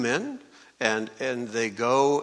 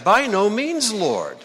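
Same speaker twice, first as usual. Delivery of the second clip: emphatic refusal; 6 semitones higher, 4 dB louder than usual. Acted. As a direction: protest in a raised, louder voice. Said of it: "But Peter said".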